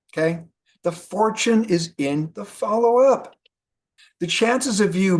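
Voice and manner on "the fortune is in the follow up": The fortune is in the follow-up, slower and higher; slow emphatic repeat. Shown as a demonstration. Speaker drilling the key lesson into the networkers, slow and emphatic. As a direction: hit "fortune" and "follow-up" slowly. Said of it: "please remember this".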